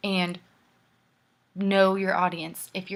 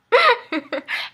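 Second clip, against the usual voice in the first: loud, high burst of laughter; high cackling laugh; 11 dB louder than usual. Real, not acted. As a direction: burst out laughing at your own joke. Cracks up at own joke.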